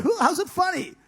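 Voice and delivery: high, indignant question; angry disbelief. Retold or imitated. Angrily questioning Vince about the joke, high and indignant.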